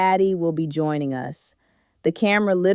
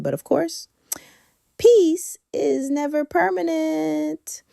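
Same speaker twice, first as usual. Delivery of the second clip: ominous tease; 12 semitones higher, slower than usual. Acted. Teasing drama after the safari.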